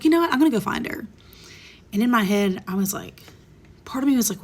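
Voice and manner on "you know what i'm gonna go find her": sudden resolve. Retold or imitated. Deciding to confront ex-friend, loud burst. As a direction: burst out higher and louder, then settle.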